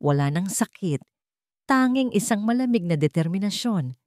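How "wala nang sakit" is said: usual speaking voice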